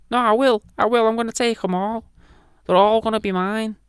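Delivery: comic high-pitched put-on voice; high-voiced Jesus. Jesus insisting he will take the souls, high comic voice. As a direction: squeak back in stubborn defiance. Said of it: "Jesus would probably be like".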